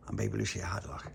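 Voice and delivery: hushed, unvoiced storytelling; whispered narration. A narrator's reading. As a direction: whisper it to build suspense.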